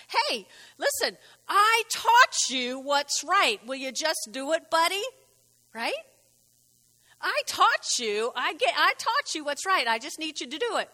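lightly voiced patient God; God coaxing Cain. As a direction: urge a stubborn buddy gently.

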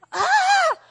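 short, high, loud "ah"; mock cry. Preacher to congregation on losing joy, acted loud cry.

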